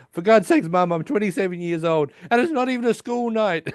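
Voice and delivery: high-pitched mock yell at Mum; exasperated retort. Grown son retorts to yelling mother.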